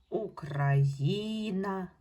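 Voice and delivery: "Україна" said slowly, third syllable drawn out; stress on third syllable. Shown as a demonstration. Teacher showing pupils the stress rule on "Україна", third syllable stretched out. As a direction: lean long on the "ї" in "Україна". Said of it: "наголос на третій склад".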